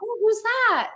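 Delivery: high, bright storybook question; sweetly hammed-up Dorothy; playful Dorothy voice. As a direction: tease a little fan like a character. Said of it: "I'll be like".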